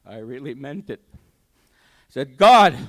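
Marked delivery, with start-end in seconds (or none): 2.4-2.8 a very loud, high call; crying out to God. Retold or imitated. He finally means it and cries out to God, very loud and high.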